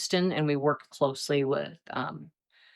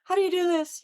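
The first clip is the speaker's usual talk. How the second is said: panicked question; 13 semitones higher, faster than usual. Retold or imitated. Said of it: "And I'd ask".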